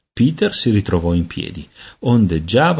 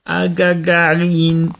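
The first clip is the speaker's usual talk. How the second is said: higher, very slow measured babble; calm baby babble; 9 semitones higher, much slower than usual. A narrator's reading. Peter calmly explaining the spell to his aunt, slow babble. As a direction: try to speak calmly, only babble comes. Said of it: "con voce pacatissima disse".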